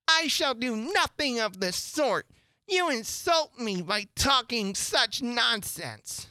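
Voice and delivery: high, slow, huffy indignation; offended rebuke. A narrator's reading. Insulted Mouse rebukes Alice, high and indignant. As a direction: take great offence, stretching the words.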